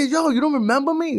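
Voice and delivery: high-pitched, incredulous challenge; acted-out pointed question; challenging question. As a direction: press him to remember you. Retold confronting the cop.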